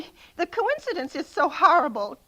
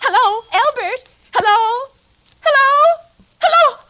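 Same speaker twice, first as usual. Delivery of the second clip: higher and louder with each call; frantic calling; 8 semitones higher, 9 dB louder than usual. Acted. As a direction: shout desperately into the dead line. Frantically answering a silent phone.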